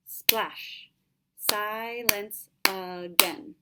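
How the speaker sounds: slow, each syllable spaced out; syllable counting. Shown as a demonstration. Teacher counts the last line's syllables slowly for students. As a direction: tap out each beat slowly. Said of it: "Let's look at the syllables".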